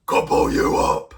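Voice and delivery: storybook troll threatening the goat. Acted. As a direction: repeat the troll's growl. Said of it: "when the troll said".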